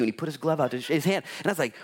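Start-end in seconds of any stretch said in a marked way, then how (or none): none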